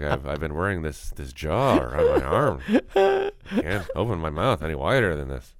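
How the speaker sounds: low mumble with lips nearly closed; Jeff Goldblum voice. Retold or imitated. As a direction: barely move the lips, unenunciated and low. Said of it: "you keep your lips together and you talk like this".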